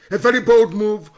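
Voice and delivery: emphatic loud repeat. Real, not acted. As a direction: raise the voice on bold. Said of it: "repeats the praise, louder the second time".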